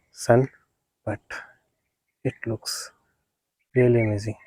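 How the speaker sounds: ordinary talk, nothing marked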